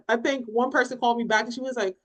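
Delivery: normal talking voice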